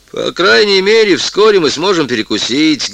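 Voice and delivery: loud, high announcement to nobody. Acted. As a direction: say it loudly to the whole cabin. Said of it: "громко сказал он".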